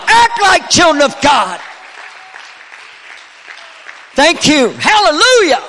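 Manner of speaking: loud, high revival cry; rising shout, declaring it over the church; preacher crying out for healing and revival; full-force preaching. Real, not acted. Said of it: "I didn't mean to get anointed".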